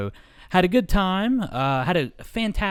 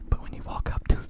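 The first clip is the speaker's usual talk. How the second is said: ASMR whisper. Acted. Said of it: "full A S M R action".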